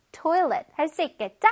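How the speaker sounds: ordinary talk, nothing marked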